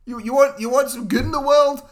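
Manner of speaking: loud, high, hyped-up proclamation; excited shout. Real, not acted. Podcaster championing his pick to co-host, excited shout. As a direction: belt it out like a big pitch. Hyped pitch for replacement show.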